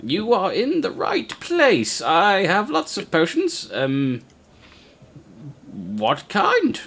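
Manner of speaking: halfling shopkeeper. Acted. Halfling shopkeeper offers potions.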